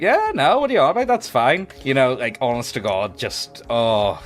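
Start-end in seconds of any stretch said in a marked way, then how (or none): 0.0-1.7 high, loud breezy denial; mock dismissive owner. Shady owner dismissing concerns, high and loud. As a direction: brush it off loudly. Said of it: "they'll be like".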